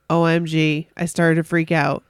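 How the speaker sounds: ordinary talk, nothing marked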